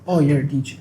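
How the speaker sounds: imitating someone unimpressed; mock dismissive reaction. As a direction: say it as if the job is nothing special. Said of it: "It's like".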